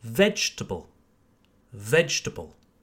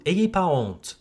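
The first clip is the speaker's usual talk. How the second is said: ordinary talk, nothing marked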